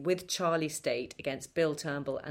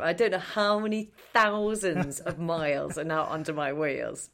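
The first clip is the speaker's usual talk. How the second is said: usual speaking voice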